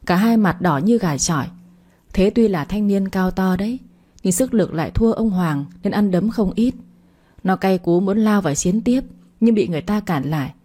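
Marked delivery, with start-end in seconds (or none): none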